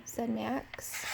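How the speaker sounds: plain reading voice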